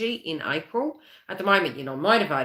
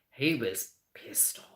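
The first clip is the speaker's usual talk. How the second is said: whispered aside; 4 semitones lower, 7 dB quieter than usual. Real, not acted. Confiding aside about angry dad.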